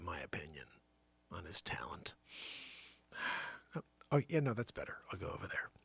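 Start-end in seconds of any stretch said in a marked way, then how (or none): 5.1-5.7 whisper. Acted. Whispered aside in the scene.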